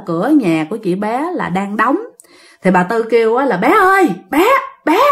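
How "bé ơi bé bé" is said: calling out. Retold or imitated. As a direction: call her name again and again. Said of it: "kêu quá trời luôn".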